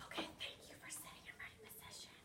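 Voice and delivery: soft whispered praise; whispering. Real, not acted. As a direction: whisper your thanks so the class hushes. Teacher quietly praises the class.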